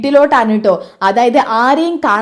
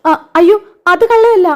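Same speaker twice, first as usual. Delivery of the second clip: much higher, louder flustered denial; panicked; 7 semitones higher, 3 dB louder than usual. Acted. Caught daughter panicking before her mother. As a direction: scramble to deny it.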